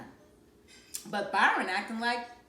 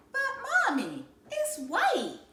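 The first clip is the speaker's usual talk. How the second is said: put-on child voice; 13 semitones higher than usual. A narrator's reading. Joey objects.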